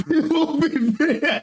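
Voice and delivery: high, drawn-out words through a laugh; real laughter lifting the voice high. Real, not acted. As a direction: crack up while trying to talk. Laughing at the others' cupcake plan.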